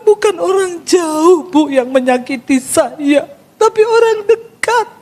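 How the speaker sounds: slow, high, tearful voice of the woman; mock tearful. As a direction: act her weeping as she confesses. (suara menangis tiruan, pelan dan tinggi)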